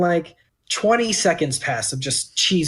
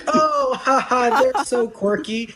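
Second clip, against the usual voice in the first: high, slowing mock voice of friends; mocking table friends; 7 semitones higher, slower than usual. Waiter mocking the diners' gushing about cheese. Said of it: "the entire table is like".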